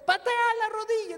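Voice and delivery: loud, high commanding order; loud high shouted command; loud final command. Retold or imitated. God commands a third time.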